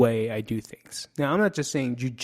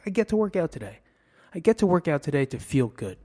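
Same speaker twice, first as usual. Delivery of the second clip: brighter, higher, positive self-talk; upbeat gratitude; 5 semitones higher, faster than usual. Acted. Reframing the workout as a treat, upbeat gratitude. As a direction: say it like a privilege. Positive take against the have-to.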